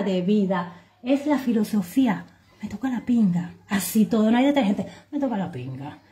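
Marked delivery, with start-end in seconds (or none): 5.1-6.0 muttered. Acted. Repeated catchphrase, muttered.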